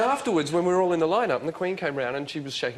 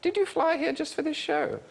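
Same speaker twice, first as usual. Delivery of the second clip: much higher, slow regal voice; slow, pitched-up Queen impression; the Queen asking, done high and slow; imitating the Queen. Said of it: "she actually said".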